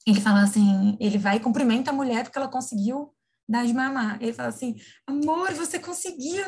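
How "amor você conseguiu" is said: put-on higher, slow, sing-song praise like to a little kid; mock a husband praising his wife like a toddler; talking down to a child. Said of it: "Trata como se fosse criança". (pai elogiando a mulher como se fosse criança, em tom infantilizado)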